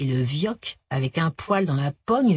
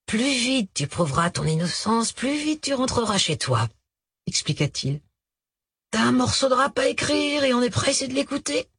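mock conciliatory; 5 semitones higher than usual. A narrator's reading. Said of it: "faussement conciliante".

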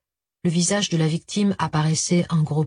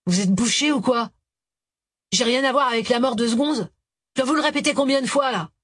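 rising, exasperated and quick; irritated; 5 semitones higher than usual. A narrator's reading. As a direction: fire it off, fed up. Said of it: "soupira d'irritation".

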